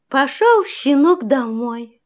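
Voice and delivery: plain reading voice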